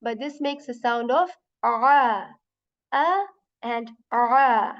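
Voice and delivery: a drawn out slowly from the throat; ain sound contrast. Shown as a demonstration. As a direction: stretch each a slowly with a choked start. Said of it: "hear the difference".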